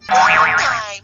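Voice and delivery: ordinary talk, nothing marked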